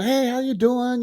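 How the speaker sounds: high, slow, sing-song hey; cheery greeting. Retold or imitated. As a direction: greet like a kid bumping into an old coach. Kids greeting their old coach.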